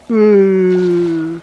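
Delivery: loud drawn-out zombie moan; zombie groan. Pretend. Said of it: "Great impression of a zombie there".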